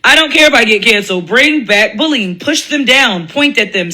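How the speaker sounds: ordinary delivery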